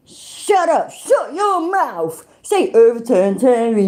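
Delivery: high mock impression of a ranting man, slowed on shut your mouth; mocking imitation. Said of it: "and say".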